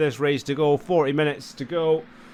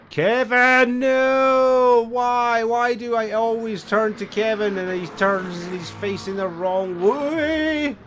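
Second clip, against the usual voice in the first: louder and higher, groaning at a driver gone backwards; exasperated outburst; 6 semitones higher, 5 dB louder, slower than usual. Real, not acted. Commentator yelling at a spun driver, loud dismayed outburst. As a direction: cry out in real frustration at the spin. Driver spins at bad moment.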